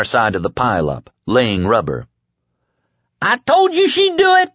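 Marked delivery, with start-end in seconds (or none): 3.2-4.5 very high, exultant yell; triumphant scream. A narrator's reading. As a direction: crow it at a scream. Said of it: "the kid screamed triumphantly".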